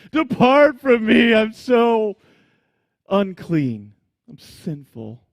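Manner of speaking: loud, slow mock-anguished plea; drama-queen Peter begging Jesus to leave; dramatic Peter. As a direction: ham up Peter's grovelling despair. Preacher acting out Peter's plea.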